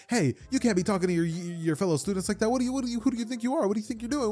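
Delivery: high, rushed telling-off, tripping over words; hammed-up flustered reprimand; indignant scolding classmate. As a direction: scold in a put-on high voice, sputtering. Ida scolding Deku, acted out.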